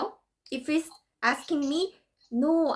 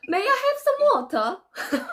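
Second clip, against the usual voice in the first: lilting, higher-pitched British accent; 6 semitones higher than usual. Shown as a demonstration. Demonstrating British English to a friend, playful British accent. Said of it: "British accents are like".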